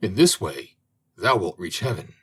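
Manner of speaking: ordinary delivery, nothing marked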